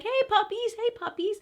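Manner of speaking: very high sing-song voice for the puppies; dog baby talk. Retold or imitated. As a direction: coo at the dogs like a dog lover. Police officers greeting the dogs.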